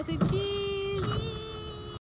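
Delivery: high sing-song cheese. Real, not acted. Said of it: "Everybody look at the camera".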